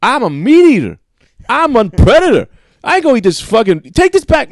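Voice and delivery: high, loud indignant yell; mock outrage, shouting he wants meat; a man refusing a veggie sandwich, yelling high and loud; furious yelling man. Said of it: "the guy just goes".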